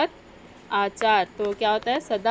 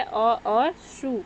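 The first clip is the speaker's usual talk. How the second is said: slow, separated; much slower than usual. Shown as a demonstration. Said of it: "यहाँ पे दो उपसर्ग लगा है".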